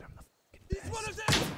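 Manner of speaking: slow, high-strained whisper; whispered boast. Real, not acted. Gamer gloating to himself, whispered boast. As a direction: whisper it slowly to yourself.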